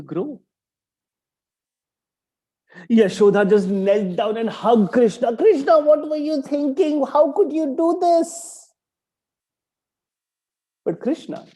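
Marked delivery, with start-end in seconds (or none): 5.4-8.7 high, loud scolding mother; mother scolding her runaway son; worried mother. Acted. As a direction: scold him like a frightened mum.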